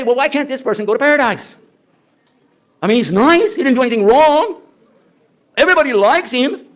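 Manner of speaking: lightly voiced complaint; mock objector. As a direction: whine on their behalf. Voicing objectors, raised pitch.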